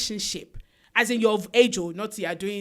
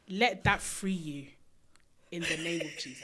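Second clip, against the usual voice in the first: solemn blessing; 6 semitones lower, 8 dB quieter than usual. Pretend. Host releasing girls from bad love, solemn and quiet. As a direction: let it sink down softly at the end.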